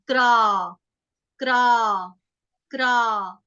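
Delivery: Kra drawn out on a long aa, pitch falling. Shown as a demonstration. Teacher drills the vowel sign, Kra with a long falling aa. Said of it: "उसके साथ है आ की मात्रा".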